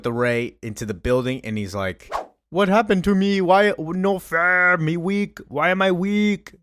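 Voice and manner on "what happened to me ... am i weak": high, slow broken-grammar Bizarro, louder than his own. Retold or imitated. Bizarro asks Jimmy why he feels weak, in a high, slow, pushed voice. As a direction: play the backwards clone, confused by his weakness. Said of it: "Talking like Bizarro usually does".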